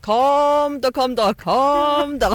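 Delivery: slow, drawn-out call to the cows; calling the animals. Shown as a demonstration. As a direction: lure the cows home with a long call. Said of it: "hvordan det var?". (langtrukkent lokkerop til kyrne)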